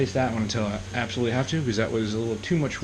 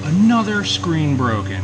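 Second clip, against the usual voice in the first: louder, slow exclamation of disbelief; dismayed outcry; 4 semitones higher, 6 dB louder, slower than usual. Real, not acted. Man finds his phone screen broken, slow dismayed cry. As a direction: stretch out the groan at bad luck.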